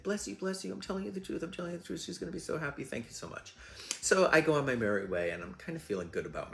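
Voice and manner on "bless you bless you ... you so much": high-pitched, pleading thanks; put-on high voice, gushing and grateful; imitated tearful gratitude. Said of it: "he starts to cry".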